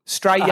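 "Australia" as "stray" plus "ya"; like stray dog. Shown as a demonstration. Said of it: "Think, think stray dog".

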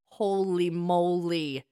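ordinary talk

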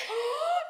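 high, loud mock gasp; shocked gasp. Friend seeing Allie about to fight, high acted gasp. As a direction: gasp in exaggerated alarm.

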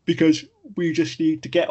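yawning while talking; words stretched by a yawn. Real, not acted. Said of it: "I'm yawning again".

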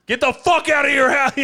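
high, loud comic holler; mock intruder yell. Corey bursting through the neighbour's wall, mock yelling. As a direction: yell it as a playful wall-busting threat. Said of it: "And Corey goes".

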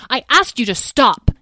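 annoyed sister yelling at sibling; yelled. Acted. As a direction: yell it back defensively. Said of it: "yelled Petra".

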